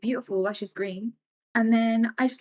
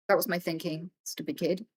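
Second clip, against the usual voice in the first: usual speaking voice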